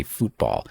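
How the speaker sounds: "football" said as "fútbol", long oo as in food; futbol pronunciation. Shown as a demonstration. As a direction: say "football" as F-U-T with an accent on the u, "FOOT" rhyming with boot. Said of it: "as in F U T".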